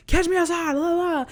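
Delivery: high, sing-song schoolyard taunt; mock taunting. Nosy parent starting drama with others, mocking taunt. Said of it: "the parent to be like".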